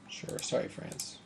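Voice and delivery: unvoiced whisper. Real, not acted. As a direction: breathe it out quietly. Whispered aside.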